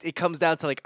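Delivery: ordinary talk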